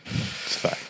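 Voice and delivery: "It's fine" whispered under breath. Real, not acted. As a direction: hush it to yourself. Repeat, second whispered.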